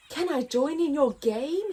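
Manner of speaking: lightly voiced eager asking; hopeful giraffe. Giraffe asking the lions to play, hopeful. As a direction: ask hopefully. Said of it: "he asked the lions".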